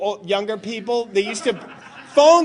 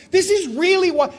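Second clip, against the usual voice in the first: normal talking voice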